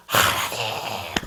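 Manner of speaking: drawn-out, low anguished cry to God; mock wailing cry. 통곡하는 신자를 흉내 내며 길게 울부짖듯. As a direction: stretch it slowly, lamenting. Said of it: "가슴을 찌고 울면서 통곡을".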